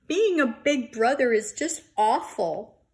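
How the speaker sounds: a hint of a sulking boy; upset complaint. Bear upset after scolding.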